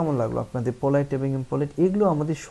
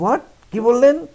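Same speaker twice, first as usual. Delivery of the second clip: high, very loud rude what; 11 semitones higher, 11 dB louder, slower than usual. Shown as a demonstration. Teacher shows the rude way to ask someone to repeat. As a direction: bark it rudely. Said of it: "এভাবে বলবো না".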